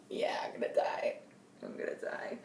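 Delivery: voiceless whisper, fading away; doomed whisper. Retold or imitated. Teen retelling that nobody replied, hopeless whisper. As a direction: whisper it like giving up.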